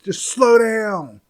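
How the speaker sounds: high, loud and slow, every word spat out; furious girlfriend demanding he slow down; livid yell. Retold or imitated. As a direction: girlfriend livid at her boyfriend's driving, loud and drawn out. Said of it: "She was livid".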